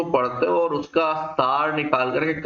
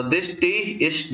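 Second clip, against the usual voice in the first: very slow reading; 4 semitones higher, much slower than usual. Shown as a demonstration. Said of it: "देखो एक एक करके check करिएगा".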